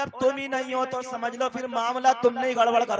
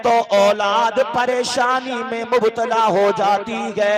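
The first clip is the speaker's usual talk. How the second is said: ringing sermon, stretched out at the close; forceful preaching; 5 dB louder than usual. Acted. Preacher warning ungrateful parents about their children, forceful preaching. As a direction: build to a drawn-out preacher's finish.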